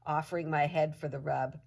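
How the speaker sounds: usual speaking voice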